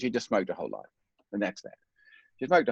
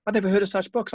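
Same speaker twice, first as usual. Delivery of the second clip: amazed; 10 semitones higher, 4 dB louder, faster than usual. Real, not acted. Repeats line with rising amazement.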